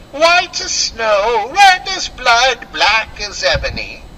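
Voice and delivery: high, slow witchy gloat; cackling queen savoring her victory; wicked queen. Acted.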